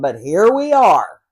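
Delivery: usual speaking voice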